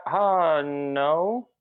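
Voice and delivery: drawn-out, unconvincing denial; hesitant no. Acted. Answering whether the dress looks fat, hesitant. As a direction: stretch the no as if unsure.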